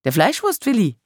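high-pitched, astonished outcry; aunt's exclamation. A narrator's reading. Aunt hearing he was once attractive, exclaiming. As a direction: call it out high, in disbelief. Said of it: "rief meine Tante".

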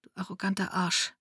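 muttered insult under her breath. Acted. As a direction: mutter it quietly to his back. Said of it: "ich murmelte ihm hinterher".

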